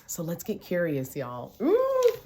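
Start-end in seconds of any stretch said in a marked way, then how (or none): 1.6-2.1 high, loud "ooh"; excited ooh. Real, not acted. Coach hyping up her viewers, high excited ooh. Coach exclaims after urging curiosity.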